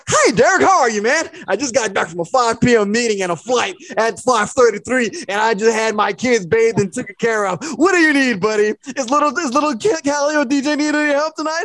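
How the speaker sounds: high, bright helpful dad voice; friendly suburban dad. Retold or imitated. Godfather calling Derek to offer help, cheerful and high.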